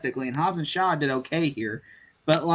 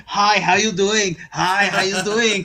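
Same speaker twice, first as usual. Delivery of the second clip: slow, louder glad-handing greeting; lightly voiced celebrity working the crowd; retold star greeting; 2 semitones higher, 5 dB louder, slower than usual. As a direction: greet fans slowly and loudly, imitating a star.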